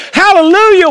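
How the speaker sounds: hallelujah ringing out high and loud, then falling; hallelujah shout. Real, not acted. Pastor calling out praise to the congregation, shouted. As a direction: cry out the praise.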